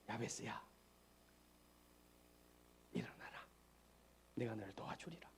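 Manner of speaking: soft, breathy whisper; God whispering to Jabez to rise; God's whispered call. Acted. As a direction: call him gently in a whisper. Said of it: "말씀하고 있습니다".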